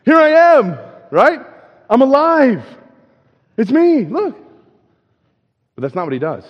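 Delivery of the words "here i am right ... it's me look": high, loud gleeful ta-da; playful mock triumph, much higher and louder; triumphant reveal. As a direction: burst out with a cheeky here I am. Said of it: "I'd be like".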